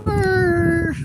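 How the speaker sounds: loud mock shiver from cold; loud shivering brr. Pretends ghost chill.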